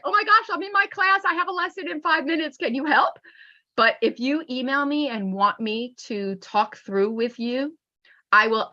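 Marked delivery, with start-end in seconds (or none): 0.0-3.2 quick, high, flustered plea; panicked rush. Retold or imitated. Teacher imagining a panicked last-minute plea, fast, high and flustered. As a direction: rattle it off like a teacher late for class. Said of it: "not when you're like".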